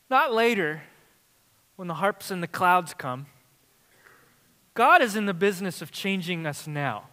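loud, lifted preacher's voice with long pauses; full-force preaching. Acted. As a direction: preach it out over the congregation.

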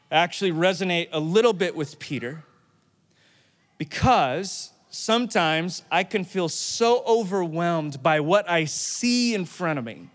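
ordinary delivery

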